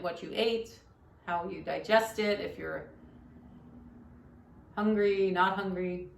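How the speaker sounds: soft-spoken yoga teacher leading a meditation; guided meditation. Shown as a demonstration. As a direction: guide listeners gently into stillness, quietly.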